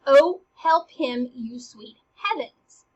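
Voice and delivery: a loud, drawn-out plea that eases off; crying out. Acted. Ophelia crying out to God for Hamlet, slow plea. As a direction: cry out "oh", then slow the plea. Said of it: "she cries out to God".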